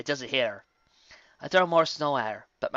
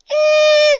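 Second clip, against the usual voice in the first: very high, loud squeal; high yell; 17 dB louder than usual. A narrator's reading. Pony swallowing icy snow, high yell. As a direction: cry out shrilly from the cold. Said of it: "I yell, swallowing the snow".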